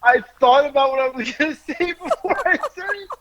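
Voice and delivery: words pushed out through giggles, high and fast; laughing while talking. Real, not acted. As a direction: try to talk but keep cracking up. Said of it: "I was gonna get the story out".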